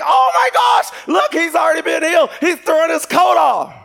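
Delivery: high, loud shout of astonishment; amazed onlooker. Acted. Onlooker seeing the blind man throw his coat, amazed shouting. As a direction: cry out like a stunned bystander.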